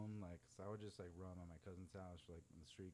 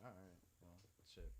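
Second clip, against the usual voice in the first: deflated resignation; 8 dB quieter than usual. Retold or imitated. Deflated after being told off.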